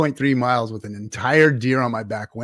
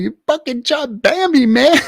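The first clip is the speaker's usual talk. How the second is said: much higher, louder accusing yell; outraged shout; 11 semitones higher, 8 dB louder than usual. Retold or imitated. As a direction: yell it at the buddy in mock horror. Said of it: "I was like".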